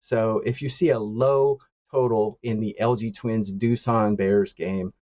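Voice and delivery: normal talking voice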